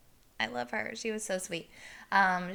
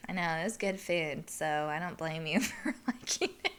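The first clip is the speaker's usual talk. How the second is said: ordinary delivery, nothing marked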